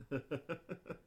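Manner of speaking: quiet chuckle; soft laugh. Real, not acted. Veteran amused by his own story, soft chuckle. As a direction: chuckle softly to yourself.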